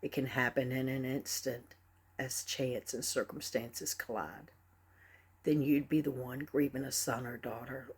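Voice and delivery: soft, low, measured spoken-word delivery; solemn poem recital. Acted. Poet reciting to a grieving audience, soft and solemn. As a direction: recite it gently, letting each line land.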